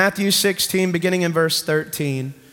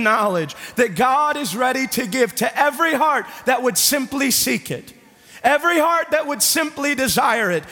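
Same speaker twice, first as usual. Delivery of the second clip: fervent preaching; 7 semitones higher than usual. Acted. Pastor urging the congregation about revelation, building fervour. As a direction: drive it home to the church.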